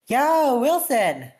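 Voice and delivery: bright, high, loud call across the dock; cheerful call. Acted. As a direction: shout his name gleefully. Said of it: "A cheerful voice pierced".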